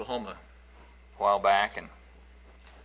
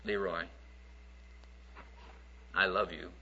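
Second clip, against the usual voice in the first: soft, low and tender; voice of the Lord; 5 semitones lower, 14 dB quieter than usual. Retold or imitated. The Lord gently telling Leroy he loves him, soft and low. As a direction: speak it gently as God would. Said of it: "And the Lord said".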